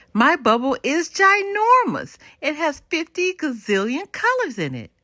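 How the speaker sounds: high and loud, bragging with delight; boastful excited child. Acted. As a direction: boast like a small boy who thinks his bubble is the biggest. Little brother boasting about bubble.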